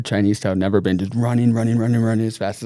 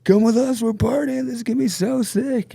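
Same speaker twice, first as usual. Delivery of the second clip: imitating a drunk friend, much higher; 13 semitones higher than usual. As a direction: play the wasted buddy begging to party. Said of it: "Austin's just like".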